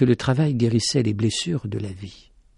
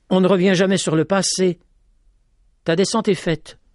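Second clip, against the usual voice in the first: a touch higher, steady and firm; 6 semitones higher than usual. A narrator's reading. Petit-Jean tells the lady firmly, no going back. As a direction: state it as settled. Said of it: "d'un ton ferme".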